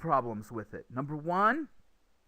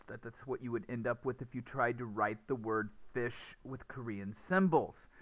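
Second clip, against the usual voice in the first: normal talking voice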